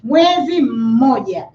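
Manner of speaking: "mmoja" with the first M held as its own beat; slow "Mwezi mmoja", humming the double M; nasalized syllabic M. Shown as a demonstration. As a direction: hum the M before "moja" in "mmoja". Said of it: "So it will be nasalized".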